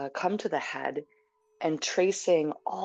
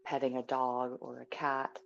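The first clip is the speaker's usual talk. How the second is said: soft, unhurried meditation guidance; guided meditation; 3 dB quieter than usual. Real, not acted. Teacher settling a group into stillness before a sit, soft guided meditation. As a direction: ease them gently into stillness.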